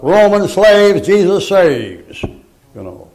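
loud, slow, high street-preaching cry; street preacher shout. Retold or imitated. Old preacher calling out slogans to passersby, loud and slow. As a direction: belt it out like preaching from a truck bed.

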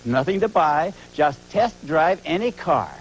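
loud hard-sell shout. Acted. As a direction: yell the pitch at the crowd. Shouted ad pitch.

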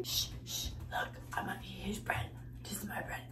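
sneaky hushed whisper. Real, not acted. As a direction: whisper it like a secret. Sneaky whisper about stealing bread.

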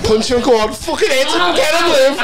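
high cheering yell; yelling at a horse race. Real, not acted. Egging on birthday punches.

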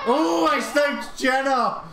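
thrilled yell at the kill; excited shout. Real, not acted. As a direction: shout it in delight.

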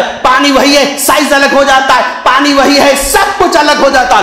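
rhythmic, high-pitched sermon listing; impassioned preaching. Acted. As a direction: drive each phrase home like a preacher. (जोशीली लयबद्ध तक़रीर)

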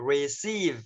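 receive with the ee held long; stretched ee. Shown as a demonstration. Teacher shows receive, ee stretched. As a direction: draw out the ee in receive. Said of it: "kéo dài ra thế là được rồi".

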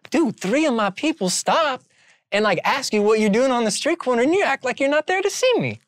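mock the big boss scolding you; Rob Dyrdek impression. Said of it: "our boy has a Rob Dyrdek impression".

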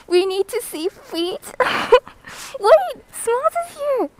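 high, loud laughing squeal; excited laughing squeal. Real, not acted. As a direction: laugh then squeal the name. Laughing then greeting a viewer.

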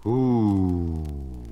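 a low, drawn-out owl hoot. Acted. Miss Oliver the owl wakes up and hoots, low and long. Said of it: "wake up and say".

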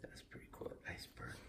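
hushed whisper. Real, not acted. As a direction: admire it in a whisper. Dad filming up close.

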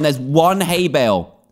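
normal talking voice

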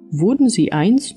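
ordinary delivery

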